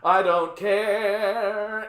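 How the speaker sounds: stretched, slow, loud defiance; slow defiant drawl. Real, not acted. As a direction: drag every word out defiantly. Drawn-out defiant ranting.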